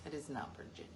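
unvoiced whispered aside. Real, not acted. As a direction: whisper it softly.

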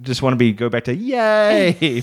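ordinary delivery

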